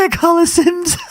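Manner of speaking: incredulous high laugh; high mocking echo. Real, not acted. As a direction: squeak it out in disbelief.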